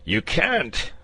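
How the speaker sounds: the dragon crowing its win; triumphant dragon refusal. A narrator's reading.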